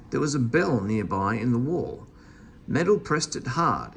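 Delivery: ordinary delivery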